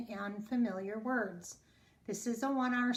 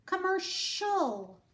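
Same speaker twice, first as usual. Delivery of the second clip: "Commercial" said higher and slowly; higher demo word; 8 semitones higher, slower than usual. Shown as a demonstration. Teacher presenting the word to the class. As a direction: lift and stretch "Commercial".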